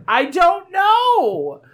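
very high, drawn-out mock wail; hammed-up helpless cry, long last word; retold wail. Answering the tortured friend, hammed-up high wail. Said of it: "I was like".

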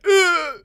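high, loud exaggerated "ugh"; dismayed groan. Host recalling the website invoice, high loud dismayed groan. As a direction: groan loudly in mock pain. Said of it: "I was like".

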